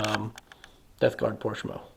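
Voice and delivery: ordinary talk, nothing marked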